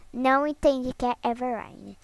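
usual speaking voice

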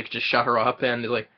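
ordinary talk, nothing marked

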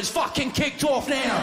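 high, drawn-out fan's yell; shouting. Retold or imitated. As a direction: roar it out like a rowdy supporter. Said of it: "He shouts".

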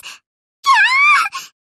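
long high shriek of pain; hit scream. Acted. Carrier struck by enemy bombs, a long high scream.

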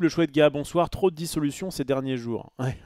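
ordinary talk, nothing marked

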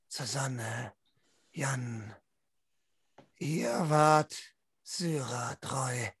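usual speaking voice

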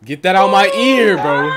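loud, high protest; annoyed shout. Real, not acted. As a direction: yell to make the noise stop. Protesting a sound in the ear.

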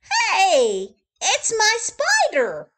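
put-on high, loud, laughing boy; excited shout. Billy shouting with joy at his spider, high and loud. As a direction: shout with delight. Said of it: "Billy shouted".

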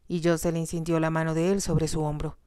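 plain reading voice

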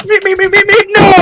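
high, loud panicked calls; panicked cries. Real, not acted. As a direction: cry out in panic. Dying in game, panicked calls.

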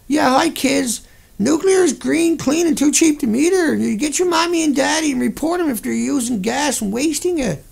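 mock kids' announcement. Streamer parodying nuclear ad aimed at kids, hammed-up cheery voice. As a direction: talk down to kids like a cheesy ad.

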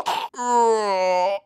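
high yelp as he's knocked out; short pained cry. Acted.

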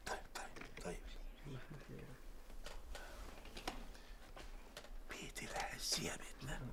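whispered singing; whispering. Real, not acted. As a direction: sing under your breath. Father whispers song in meeting.

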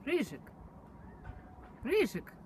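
high sing-song call; calling a pet. Real, not acted.

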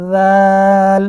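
জাল with a soft th-like z and long a; soft articulation. Shown as a demonstration. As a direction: soften the first sound of জাল, then hold the vowel loudly. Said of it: "একটু নরম করে উচ্চারণ". (নরম করে জাল টেনে)

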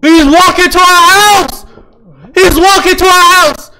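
high, loud alarmed scream of words; panicked shout. Real, not acted. Gamer sees the stalker near home, panicked high shout. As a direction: shriek the warning, stretched and high.

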